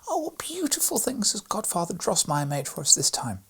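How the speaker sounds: high, bright-eyed exclamation of delight; a little girl squealing with joy; joyful exclaim. A narrator's reading. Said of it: "clapped her little hands together joyfully and exclaimed".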